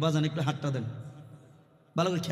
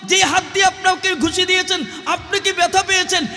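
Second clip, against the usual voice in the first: high, raised preacher's cry; full-force preaching; 13 semitones higher, 5 dB louder than usual. Retold or imitated. Preacher retells asking his attacker about pain, high impassioned cry. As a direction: proclaim the question high over the crowd.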